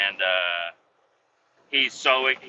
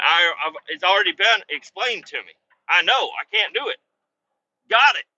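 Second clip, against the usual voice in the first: higher, louder, fed-up acknowledgement; retold testy interruption; exasperated retort; 5 semitones higher, 6 dB louder than usual. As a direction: cut him off, rising to a loud finish. Cutting off boss's explanation, retold.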